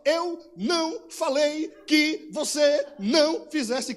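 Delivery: slow, loud, each syllable hit like a slipper blow. Retold or imitated. Mother scolding her child, each syllable a blow. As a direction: punch out every single syllable slowly and loudly. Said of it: "batia por separação de sílaba".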